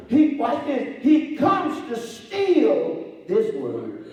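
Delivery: loud, slow preached warning; slow it down and warn them; preaching warning. Acted. Preacher warning the church about the enemy, slow and loud.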